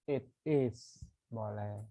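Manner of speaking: ordinary talk, nothing marked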